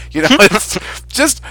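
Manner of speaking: ordinary talk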